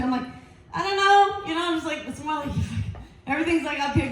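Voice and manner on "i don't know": high-pitched helpless answer; flustered reply. Retold or imitated. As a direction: squeak it out, mock-flustered. Flustered reply to chatty doctor.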